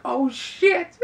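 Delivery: sudden high-pitched gasp of shock. Pretend. Viewer reacting to the twist ending, shocked high yelp. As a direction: blurt it out like the twist just hit you. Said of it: "You're gonna be like".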